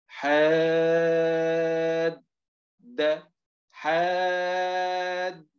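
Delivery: حاد chanted very slowly with the alif held long; slow spelled-out drill. Shown as a demonstration. Teacher spelling the word aloud for trainees, drawn-out chant. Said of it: "نتهجى مثل هذه الكلمة هكذا".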